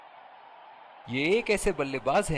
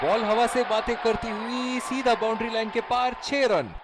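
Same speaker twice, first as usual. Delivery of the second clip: higher, rising shout over the boundary; excited cricket commentary; 4 semitones higher than usual. Acted. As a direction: ride the ball over the rope. Calling a six live.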